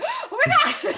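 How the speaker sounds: very high, loud and laughing; thrilled. Retold or imitated. Hearing she got the UN job, laughing and thrilled. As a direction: burst out in delighted disbelief. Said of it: "And I was like".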